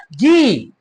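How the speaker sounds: usual speaking voice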